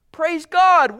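a high, loud cry of Praise God; a sudden, high burst of praise; exultant praise. Real, not acted. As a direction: shout it up in praise. Preacher's interjection.

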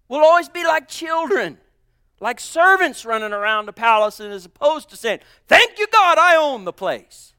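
climbing to a loud high cry; preaching crescendo. Acted. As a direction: shout the last words to the church.

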